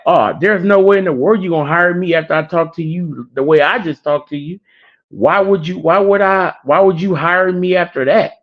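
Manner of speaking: high-pitched, incredulous objection; retold exasperated protest. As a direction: act out giving up on the job. Retold applicant protesting after rude outburst.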